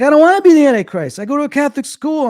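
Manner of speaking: high, retold kid's protest; panicky protest. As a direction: plead your case in mock panic. Retold child protesting being the Antichrist.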